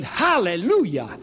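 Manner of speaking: retold loud hallelujah. Preacher retelling his cry at the cashier, mildly loud. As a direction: exclaim it, half-hearted joy. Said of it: "I said it so loud".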